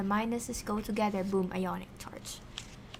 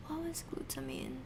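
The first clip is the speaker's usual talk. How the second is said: whispering; 7 semitones higher, 9 dB quieter, faster than usual. Real, not acted. Confused aside while studying.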